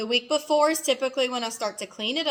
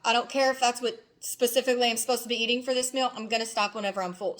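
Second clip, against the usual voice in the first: ordinary delivery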